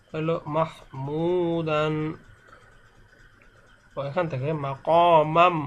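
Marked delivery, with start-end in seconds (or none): 0.6-1.9 "মাহমুদান" slow, with a long drawn-out u; recited pronunciation. Shown as a demonstration. Teacher giving only the pronunciation, slow recitation. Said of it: "শুধু উচ্চারণটা আমি বলছি". 4.6-5.6 "মাকামাম" slow, ending on a held m; ghunnah recitation. Shown as a demonstration. Teacher showing mim after tanween, slow hum. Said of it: "এই তানবিনের পরে মিম আসছে".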